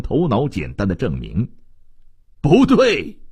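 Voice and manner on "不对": sudden high, loud objection; high-pitched outburst of disagreement; loud shout. A narrator's reading. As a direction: shout it out in sharp protest. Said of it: "大声地喊道".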